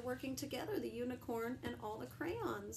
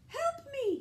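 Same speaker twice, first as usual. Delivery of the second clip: high, loud damsel cry for help; hammed-up high plea for help; pretend cry for help; 9 dB louder than usual. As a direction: squeal for help in a pretend game. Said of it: "he'd cry".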